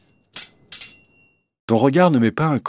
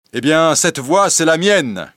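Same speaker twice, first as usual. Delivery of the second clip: lightly raised, cheerful call; a playful shout to his cousin; mild cry; 7 semitones higher, 4 dB louder than usual. A narrator's reading. Will calls out cheerfully to cousin John, voice a touch raised. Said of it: "crie Will".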